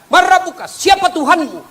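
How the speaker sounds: high, loud interrogating angel; stern angel voice. Acted. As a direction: question the dead like a fearsome angel. (suara malaikat yang menginterogasi dengan keras)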